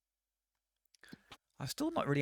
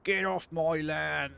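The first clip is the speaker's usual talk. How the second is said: put-on slow, louder, higher old farmer yell; grumpy farmer shout; 4 semitones higher, 8 dB louder, much slower than usual. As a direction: shake your fist and bellow it slowly. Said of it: "shaking my fist at this car".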